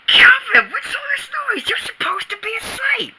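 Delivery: high, exasperated scold; scolding mother. Acted. Mother scolds Calvin for noise at night, high and exasperated. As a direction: call up the stairs at night.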